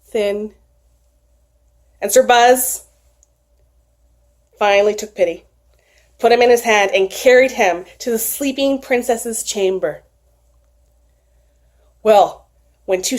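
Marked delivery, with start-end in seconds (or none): none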